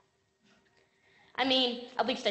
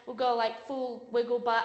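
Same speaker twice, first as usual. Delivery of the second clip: ordinary talk, nothing marked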